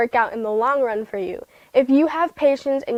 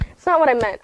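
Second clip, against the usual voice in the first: ordinary talk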